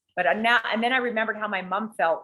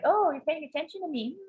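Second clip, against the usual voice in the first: flirting; 10 semitones higher than usual. Retold or imitated. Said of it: "I was doing my flirting".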